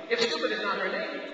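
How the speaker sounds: usual speaking voice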